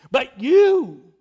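"you" hit hard and loud as an accusation; "But you" with "you" thundered and stretched; emphatic you. Shown as a demonstration. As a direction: point the word "you" straight at the listener. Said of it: "that's emphatic".

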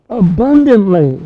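one booming preacher's shout; shouted climax. Real, not acted. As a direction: cry it out over the congregation. Preacher climaxes on a key word.

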